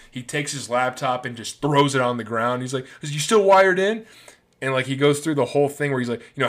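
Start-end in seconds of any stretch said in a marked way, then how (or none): 3.0-4.0 higher, louder sarcastic challenge; mocking angry jab. Betrayed friend taunting his former partner, angry and sarcastic. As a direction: throw it back at him with contempt. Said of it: "Eduardo's pissed off".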